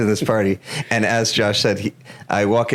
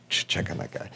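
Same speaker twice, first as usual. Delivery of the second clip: quiet inner thought; 10 dB quieter than usual. Retold or imitated. Thinking to himself at party.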